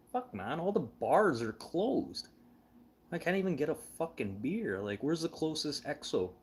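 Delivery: a stoned tourist begging to know where the beer is; exasperated plea. Retold or imitated. Drunk tourist asking for beer.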